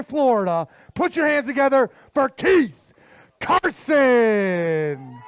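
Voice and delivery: measured build-up to a drawn-out name; stage announcer. Acted. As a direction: introduce the champ with mounting ceremony. Introduces the last angler.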